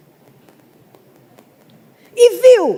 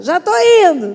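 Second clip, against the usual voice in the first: louder, higher, fed-up "Já tô indo"; mock son answering his mother, irritated; impatient child reply; 4 semitones higher, 6 dB louder than usual. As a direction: snap it back without getting up. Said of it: "Indo é um pé atrás do outro".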